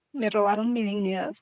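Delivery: telling the interviewer what was stolen, near tears; holding back tears. Real, not acted. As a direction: grief pushing through the words. Recalling stolen family, emotional.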